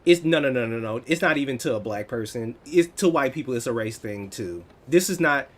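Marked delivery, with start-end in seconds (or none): none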